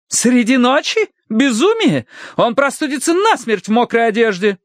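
high-pitched scandalized wife; scandalized objection. Acted. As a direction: forbid it in a high, alarmed scold. Wife objects to husband's night outing. (возмущённо, высоким голосом)

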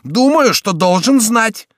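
loud, high indignant retort; heated, high-pitched emphasis; exasperated cry. A narrator's reading. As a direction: exclaim it slowly and forcefully. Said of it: "вскричал Шико".

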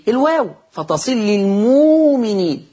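للمؤمنين sung out with a long واو in place of the hamza; hamza as waw. Shown as a demonstration. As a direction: recite للمؤمنين as lilmuuminin. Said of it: "فلما أجي أبدلها". (للمؤمنين بمدّ الواو بدل الهمز)

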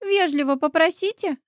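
put-on a touch of a little boy being businesslike. A narrator's reading. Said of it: "приняв деловой вид". (деловито, слегка по-детски, повыше)

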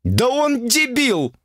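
very high, drawn-out furious outburst; exploding in indignation. Acted. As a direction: blow up at the absurdity, voice shooting up. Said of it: "взорвалась Ольга". (возмущённый взрыв)

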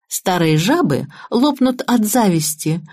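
plain reading voice

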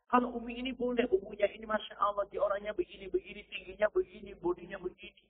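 a wife describing another woman to her husband; gossiping wife. Retold or imitated. Preacher mimics a wife gossiping softly to her husband.

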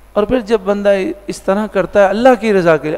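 ordinary talk

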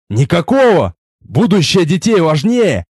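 plain reading voice

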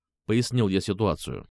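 ordinary delivery

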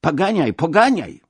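high, loud repeated command; imitated shouting. A narrator's reading. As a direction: mimic officials shouting at the coachman. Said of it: "tylko krzyczą".

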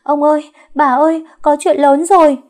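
high, loud panicked cry; screaming alarm. A narrator's reading. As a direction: shout the household awake with bad news. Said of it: "gào thét ầm ĩ".

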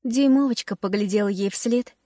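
ordinary delivery

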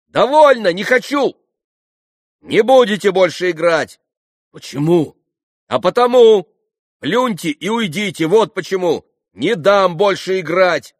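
high, loud indignant protest. A narrator's reading. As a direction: refuse with all your voice. Kalganov stops the game. (громкий возмущённый крик)

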